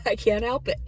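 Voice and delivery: admitting she is dramatic, laughing loudly. Real, not acted. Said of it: "I'm dramatic".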